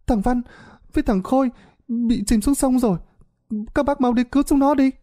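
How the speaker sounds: put-on a scared kid begging for help; panicked child. A narrator's reading. A child begs the men to save the drowning boys, panicked. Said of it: "bị một đứa trẻ khác tiếp lời".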